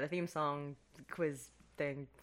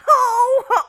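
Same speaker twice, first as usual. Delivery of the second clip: Santa laugh; 19 dB louder than usual. Retold or imitated. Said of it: "he sounded exactly like".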